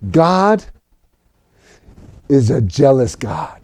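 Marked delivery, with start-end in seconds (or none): none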